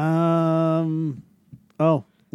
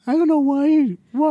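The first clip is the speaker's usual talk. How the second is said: mock high voice; 13 semitones higher, 4 dB louder than usual. Mocking parent voice in banter.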